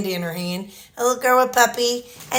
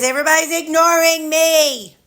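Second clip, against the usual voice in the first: high, whiny put-on dog voice; voicing the dog Sammy; 8 semitones higher than usual. Owner speaks for her ignored dog, high whiny pet voice. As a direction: whine it like a pouty, left-out dog. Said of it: "Oh, Sammy says".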